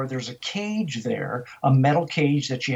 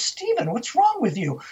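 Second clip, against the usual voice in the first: high-pitched retold scolding; exasperated rebuke; 9 semitones higher than usual. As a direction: call the name high, then scold. Retold quarrel, woman rebukes ranger.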